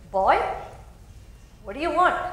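sneering demon. Acted. The demon mocks young Muruga, loud and scornful. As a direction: look down on the little boy.

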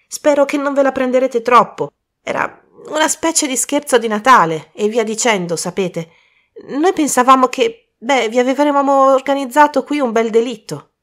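high, loud, hesitant youngster; apologetic schoolboy confessing; sheepish boy, apologetic. Acted.

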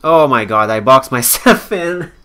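loud exclamation breaking into a laugh; shocked outburst. Real, not acted. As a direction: blurt it out loudly, appalled at your own blunder. Realizes his own game blunder.

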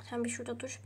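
soft whispered aside; hushed aside to someone off camera. Real, not acted. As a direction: whisper so the camera won't hear. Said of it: "whispered aside".

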